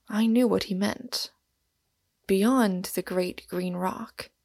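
usual speaking voice

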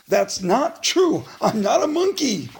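ordinary delivery

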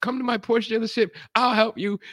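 high, keen helpful voice; high eager salesman; eager friendly offer. Retold or imitated. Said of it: "he's like".

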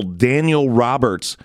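ordinary talk

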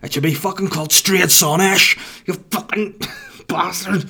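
high, loud put-on rant; mock angry local. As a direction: spit it out like an outraged neighbour. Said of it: "I'm like".

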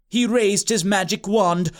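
ordinary delivery, nothing marked

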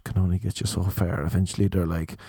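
normal talking voice

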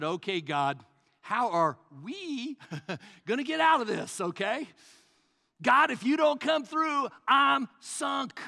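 half-laughing plea that builds to a cry; desperate prayer. Retold or imitated. Pastor retelling his prayer when cornered, pleading.

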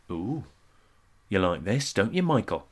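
soft, low purr; seductive murmur. Pretend. Lulu murmurs seductively to Michael in the jacuzzi. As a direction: coo it softly to him. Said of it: "She'd say".

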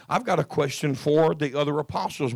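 ordinary talk

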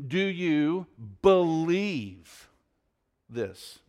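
slow pointed question. Shown as a demonstration. Pastor to congregation, slow and pointed. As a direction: draw out each word, then let this fall away. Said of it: "Did he ask it this way?".

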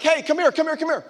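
high, quick mock hailing; calling someone over. Preacher acts out hailing a homeless person, calling over. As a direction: beckon urgently in quick repeats.

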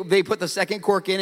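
normal talking voice

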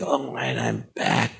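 low, soft, awed murmur; deep hushed sigh of relief; overwhelmed relief. Retold or imitated. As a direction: sink into the moment, quietly moved. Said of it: "And I was like".

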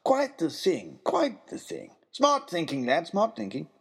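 lightly voiced cheerful old man; hearty, pleased old gentleman; a touch of a delighted elderly host; jolly old man. Character voice in story.

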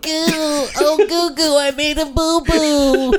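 put-on high cooing baby talk. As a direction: babble like a toddler. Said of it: "I can actually speak baby".

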